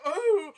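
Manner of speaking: loud, high camp cry; theatrical mock-surprised whoop; flamboyant "oh". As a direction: imitate an over-the-top swooning gasp. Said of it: "Why is he so flamboyant?".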